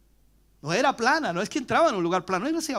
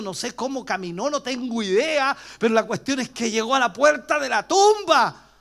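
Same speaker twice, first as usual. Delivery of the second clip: ordinary talk, nothing marked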